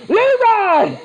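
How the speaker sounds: high, loud battle cry; wild yell. Retold or imitated. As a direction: yell it like charging in. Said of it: "I'm like".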